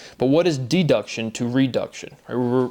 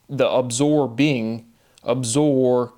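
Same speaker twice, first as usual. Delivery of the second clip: "absorbing" stretched slowly, syllable by syllable; drawn-out syllables; much slower than usual. Shown as a demonstration. Speaker showing how the word's syllables link, drawn-out syllables. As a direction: draw out the "sor" in "absorbing". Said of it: "it draws out the syllability".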